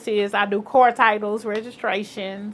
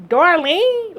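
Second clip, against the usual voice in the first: high, louder stunned echo of the name; surprised; 8 semitones higher, 7 dB louder than usual. Retold or imitated. Social worker recognizing her name, surprised high and loud. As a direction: repeat the name in disbelief. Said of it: "Like, uh".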